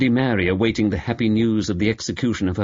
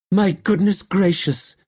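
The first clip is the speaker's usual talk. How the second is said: little outburst; 9 semitones higher than usual. Acted. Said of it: "little outbursts of".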